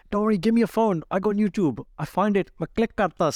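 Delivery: high, sing-out helpful patter; doing the trick. Retold or imitated. Host signing up a new subscriber, eager helpful patter. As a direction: rattle off the steps high, as a retold trick. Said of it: "I did the whole trick".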